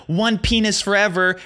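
high, sarcastic read of a slogan; mock the cheesy slogan. Mocking balloon text.

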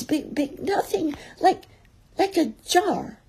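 high, squeaky, stuttering fluster; panicky stammer. A narrator's reading. Piglet describing the heffalump to Christopher Robin, frightened stammer. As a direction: trip over the words in breathless alarm.

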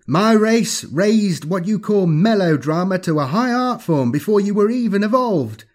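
high, loud, theatrical villain voice; gloating alien villain. Acted. Alien thing mockingly apologizing to Lachius, gloating villain. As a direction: relish the taunt, pitched high and projected.

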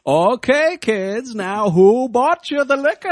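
high, drawn-out Maine sheriff voice; hammed-up small-town lawman with a Maine accent. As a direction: lean into a put-on New England sheriff. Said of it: "this small Maine town sheriff being like".

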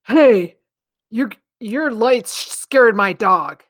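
mock the indignant neighbour; slow, loud mock grievance; dog owner complaining. Retold angry dog owner.

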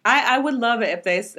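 normal talking voice

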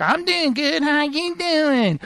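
high put-on female voice, stretched ending; mock sweet lady, drawn out; flirty bath woman. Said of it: "she's like".

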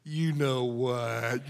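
low, slow, soft, knowing; sly parrot. Retold or imitated. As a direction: drag it out low and slow, full of meaning. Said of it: "the bird goes".

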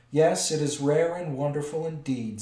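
loud, self-satisfied dragon purr; a vain dragon delighted with praise; pleased dragon. A narrator's reading. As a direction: preen at the flattery. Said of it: "absurdly pleased".

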